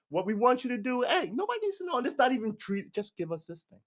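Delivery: high-pitched mock wheedling; slippery persuader act; coaxing blackmailer. Plays blackmailer coaxing the commander.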